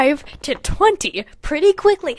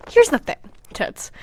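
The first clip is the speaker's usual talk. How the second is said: whispered aside; 5 semitones higher than usual. Real, not acted. Aside to viewers about the story, whispered. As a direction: lean in and whisper it.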